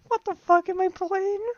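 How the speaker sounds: very high, slow laughing wail; laughing disbelief. Real, not acted. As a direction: crack up in bewilderment at the game. Cracking up at absurd game.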